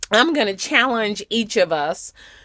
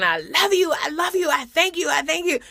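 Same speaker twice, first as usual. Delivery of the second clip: raised high, pushed voice, half-hearted yell; mock screaming; 6 semitones higher, faster than usual. Shown as a demonstration. Preacher demonstrating answering a screamer, mock yelling. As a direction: show how a yelled thank-you sounds. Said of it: "You could be screaming and saying".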